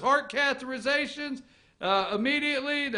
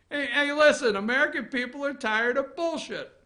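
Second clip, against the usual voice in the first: ordinary talk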